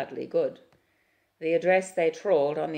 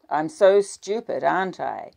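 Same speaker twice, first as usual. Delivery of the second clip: 1 bitter sarcasm; 3 semitones higher, 10 dB louder than usual. A narrator's reading.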